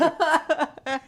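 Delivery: high, loud incredulous Rogers. Acted. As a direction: echo it back in disbelief. Mock repeating the name.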